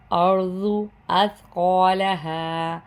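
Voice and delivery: ordinary talk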